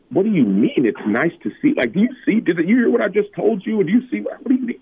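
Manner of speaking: high-pitched, baffled protest; acted-out bewildered questioning; incredulous. Acting his baffled reply to friend.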